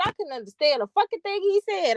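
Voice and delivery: high-pitched mock exasperation; retold exasperation. Brother who answered the phone, complaining, mock mad. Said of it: "my brother was so mad".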